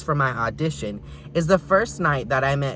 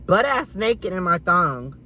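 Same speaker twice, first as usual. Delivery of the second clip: normal talking voice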